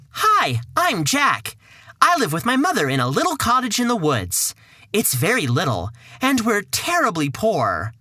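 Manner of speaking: just lift your voice a bit for the lad. Acted. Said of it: "Just slightly higher voice?".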